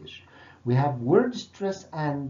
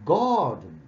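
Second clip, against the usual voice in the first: garden, louder, stress on GAR; first-syllable stress demo; 2 semitones higher, 5 dB louder than usual. Shown as a demonstration. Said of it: "مش garden، لا garden".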